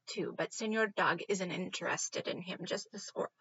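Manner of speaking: ordinary delivery, nothing marked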